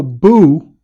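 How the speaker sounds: sudden loud boo; spooky boo. Pretend. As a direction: jump out with a scary boo. Enemy scaring believers.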